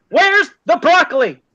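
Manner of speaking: high, loud mock demand; insistent demand. Woman returns, insisting in joke.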